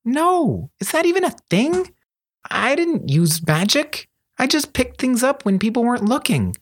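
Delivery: high-pitched incredulous denial; exasperated. A narrator's reading. Thief correcting his excited friend's wild idea, exasperated. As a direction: protest high, stressing the simple truth.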